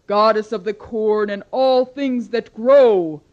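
loud commanding goddess rage; furious goddess. Acted. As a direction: thunder at the foolish mortals. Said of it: "Demeter grew so angry".

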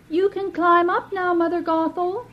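put-on high, soft young maiden calling down; a sweet girl calling from the tower window; girlish call. Rapunzel calls sweetly down to the sorceress, high young maiden voice.

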